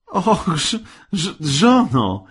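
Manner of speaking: high, stammering shock; aghast husband. A narrator's reading. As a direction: stumble over the word, appalled. Husband aghast at wife's demand.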